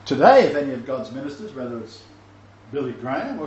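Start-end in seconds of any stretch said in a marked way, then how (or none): 0.1-1.5 sudden loud preaching. Real, not acted. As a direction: burst out loud at the congregation. Preacher booming to congregation.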